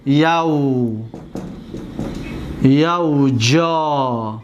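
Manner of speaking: یوجا stretched very slowly, syllable by syllable, low pitch; drawn out slowly. Shown as a demonstration. Teacher to students, drawing out یوجا very slowly.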